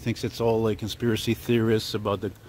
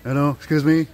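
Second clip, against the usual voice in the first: Milton from Office Space; 4 dB louder than usual. Retold or imitated. Vlogger imitating the movie character Milton for viewers, timid pleading. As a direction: plead meekly like a downtrodden clerk. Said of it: "he talks like this".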